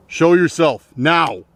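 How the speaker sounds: slow, loud, high command; demanding shout. Real, not acted. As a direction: order them out, loud and slow. Demanding at intruder.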